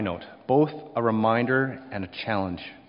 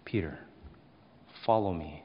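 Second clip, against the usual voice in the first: understated, simply put command, easing lower; plain, simple command; 3 semitones lower, 3 dB quieter than usual. Acted. Jesus repeating his command to Peter, plainly and simply. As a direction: give the instruction simply, settling down after the first name. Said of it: "plainly and simply".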